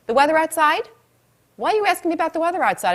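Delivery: high, incredulous questioning; puzzled, pitched well up; baffled caller. Acted. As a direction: play taken aback by an odd question. Acts caller puzzled by doctor's small talk.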